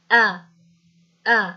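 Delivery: आ said alone as an open "aa" vowel; sound of fatha. Shown as a demonstration. Teacher answering what fatha on alif sounds like, demonstrating the vowel. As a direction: demonstrate आ as a separate, clear "ah" sound. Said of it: "इसकी आवाज़ कैसी आएगी".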